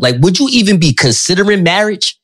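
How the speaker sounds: usual speaking voice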